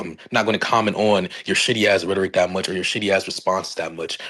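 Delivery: ordinary delivery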